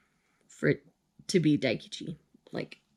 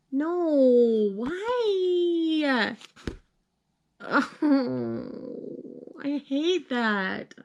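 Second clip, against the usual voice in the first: long wailing protest, higher pitch; upset; 3 semitones higher, much slower than usual. Real, not acted. Reader rejecting the ending, wailing protest. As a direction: moan it, dragging out each cry. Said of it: "I'm just so mad".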